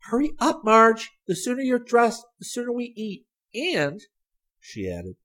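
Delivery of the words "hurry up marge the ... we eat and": put-on high, loud, eager girl's voice; eager hurrying. A narrator's reading. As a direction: rush your sister along excitedly. Sally hurrying her sister along.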